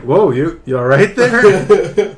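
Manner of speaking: barely holding back laughter as the customer; customer quote laughing. Retold or imitated. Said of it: "the guy was like".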